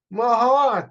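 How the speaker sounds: high, loud burst of discovery; excited cry. Acted. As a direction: cry it out as the idea strikes. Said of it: "cried Dom".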